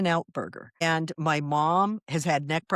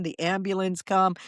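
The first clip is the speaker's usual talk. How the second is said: ordinary talk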